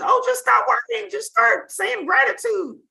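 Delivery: high-pitched imitation of easy advice; mock glib advice. Coach voicing advice she isn't giving, mock glib. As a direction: parody it high, drawing out the end.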